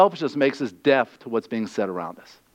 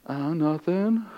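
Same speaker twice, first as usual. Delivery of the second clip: slow, much softer guilty answer; sheepish mumble; 6 dB quieter, much slower than usual. Acted. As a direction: mumble it like caught out.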